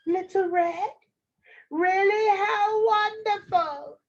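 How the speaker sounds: put-on high-pitched old granny; delighted grandmother. Grandmother hears Red at door, high delighted voice.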